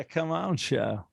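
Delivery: slow, stretched drawl; McConaughey drawl. Acted. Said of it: "how would he say the name of the show".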